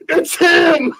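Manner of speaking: very high, loud scream of recognition; starstruck shout. Retold or imitated. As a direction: imitate a kid shrieking in excitement. Kid recognizes the TikTok guy.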